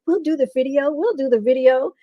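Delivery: mock-eager offer; retold excitement. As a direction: repeat it like excited friends. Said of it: "They got all excited".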